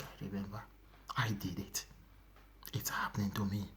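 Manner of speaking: very low, soft admission; quiet confession fading to whisper; hushed confession. Retold or imitated. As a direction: sink into a near-whisper, owning up. Voicing a sinner's quiet confession.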